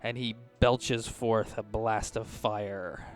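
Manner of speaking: usual speaking voice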